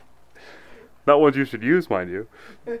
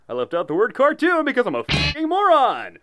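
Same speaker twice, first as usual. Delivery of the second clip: normal talking voice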